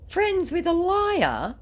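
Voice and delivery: high, slow mock disbelief. Scoffing at the scammer's friendship, high slow mock shock. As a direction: drag it out in fake shock.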